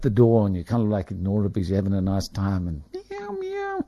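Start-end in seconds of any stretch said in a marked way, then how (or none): 2.9-3.8 very high, soft, squeaky meows; mock cat meow. A man mimicking his cat begging at the door, high squeaky meow. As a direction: squeak out a little cat's meow.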